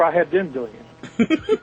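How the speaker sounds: ordinary talk, nothing marked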